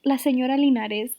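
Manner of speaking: La Señora Linares in a full Spanish accent with a tapped r. Shown as a demonstration. Reviewer naming the consulate wife in Spanish, Spanish accent. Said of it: "Why am I saying with an accent?".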